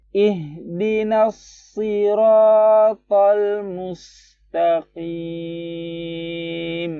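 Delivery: high, slow tilawah, mustaqim drawn out; Quran recitation. Shown as a demonstration. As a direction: chant slowly, hold mustaqim. (tilawah tinggi, mustaqim panjang)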